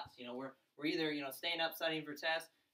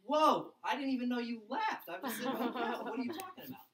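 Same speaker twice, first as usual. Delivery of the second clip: mock surprise; 7 semitones higher, 5 dB louder than usual. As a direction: act baffled he was ever gone. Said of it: "I'm like".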